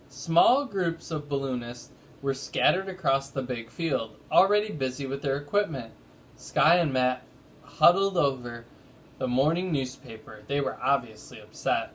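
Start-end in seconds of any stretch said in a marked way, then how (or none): none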